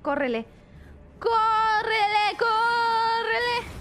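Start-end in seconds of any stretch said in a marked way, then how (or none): 1.2-3.7 very slow, stretched wailed call; long held-out urgent wail; drawn-out urgent cry. Real, not acted. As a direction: wail it, stretching each word out. Urging escape, drawn out.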